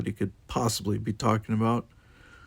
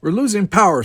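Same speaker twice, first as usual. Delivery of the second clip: high, loud alarmed report; urgent report; 10 semitones higher, 8 dB louder than usual. A narrator's reading. Navigator telling captain of damage, urgent. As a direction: call out the bad news urgently.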